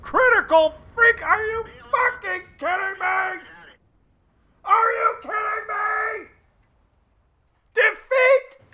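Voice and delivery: high, loud, slow incredulous shouting; outraged disbelief. Real, not acted. Commentator furious at the defeat, shouting in disbelief. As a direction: rant at the unfair loss. Player loses the match.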